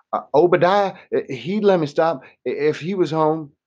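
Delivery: a frightened man whining his excuse. A narrator's reading. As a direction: whine it like a begging child. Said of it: "the man whined".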